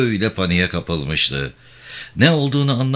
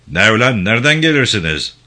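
loud, raised challenge; angry gendarme chief; 4 semitones higher, 5 dB louder than usual. Acted. Gendarme chief stops the villagers, loud and angry. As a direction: confront them like a bullying officer.

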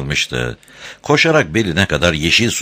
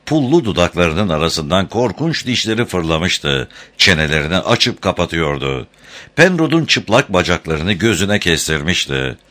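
ordinary delivery, nothing marked

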